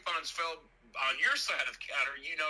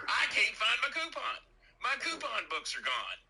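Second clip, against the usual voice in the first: rising distress; 8 semitones higher than usual. Retold or imitated. Man telling Jimmy about losing coupons, climbing distressed pitch. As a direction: voice climbing much higher in dismay.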